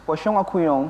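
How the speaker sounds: Pocong atau kuyong with a French lilt; French accent. Shown as a demonstration. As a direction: Indonesian ghost names Frenchified. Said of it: "kalau bahasa Perancisnya?".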